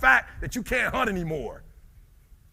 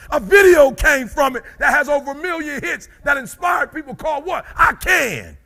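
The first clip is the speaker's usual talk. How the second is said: loud, high, driving preacher shout; preaching at full force; 10 semitones higher, 6 dB louder than usual. Acted. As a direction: preach it up to a roar. Preaching climax.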